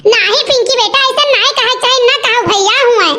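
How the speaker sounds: ordinary delivery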